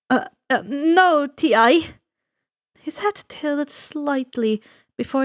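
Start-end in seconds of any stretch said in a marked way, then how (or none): 0.1-1.9 nervous, stammering answer; flustered stammer. Acted. Boy answering the scary ferryman, flustered and stammering. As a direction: trip over the words, rattled.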